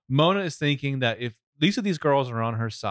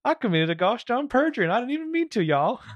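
much higher hammed-up Southern accent; exaggerated high Southern whine; Jeff Sessions Southern impression; 12 semitones higher than usual. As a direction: squeal it like a flustered Southern senator. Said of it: "that's my Jeff Sessions impression".

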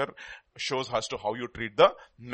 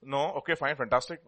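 normal talking voice

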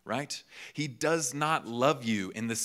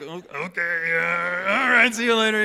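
high, loud, strained imitation of a flustered student; mock awkward goodbye; 9 semitones higher, 10 dB louder than usual. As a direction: play up an uncomfortable, squirming farewell. Imitating reluctant student getting a kiss.

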